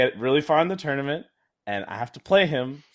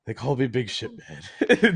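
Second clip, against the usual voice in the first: laughing punchline; 3 dB louder, slower than usual. Real, not acted. Punchline of the story.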